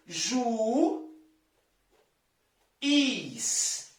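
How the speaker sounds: Ju, long pause, is; two syllables with a gap; syllable split. Shown as a demonstration. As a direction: split juiz into Ju and is. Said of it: "Nós separamos dessa forma".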